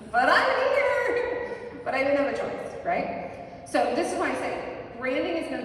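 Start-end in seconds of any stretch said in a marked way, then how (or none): 0.1-1.6 high, loud, drawn-out fake cheer; mock enthusiasm. Dog forced into a silly hat, mock cheerful. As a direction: belt it out in forced excitement. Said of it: "that's about how excited you feel".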